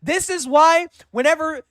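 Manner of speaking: screaming high and loud in outrage; real enraged yelling at a dodge; furious shout. Real, not acted. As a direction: blow up at him at the top of your voice. Outraged at caller dodging question.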